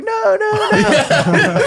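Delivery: very high squeal, half laughing; mock panicked pleading. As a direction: imitate helpless protest in a squeaky voice. Retells pleading while held up.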